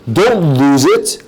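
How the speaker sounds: ordinary delivery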